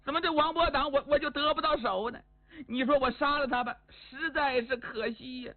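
high-pitched acted whining lament; crying. Woman laments she cannot win him, crying. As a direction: wail with frustrated longing. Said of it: "他哭了".

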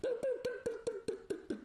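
very high, very fast deng-deng steps; staggering footsteps sound. A narrator's reading. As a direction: rattle off the stumbling steps.

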